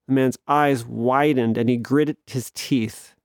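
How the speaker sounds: usual speaking voice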